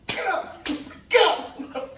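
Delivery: mock-stern parental order; scolding parent. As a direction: act out ordering a child up. Scolding child in a retold tantrum.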